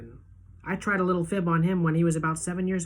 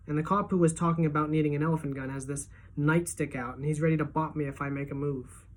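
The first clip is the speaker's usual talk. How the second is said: plain reading voice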